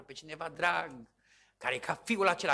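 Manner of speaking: ordinary delivery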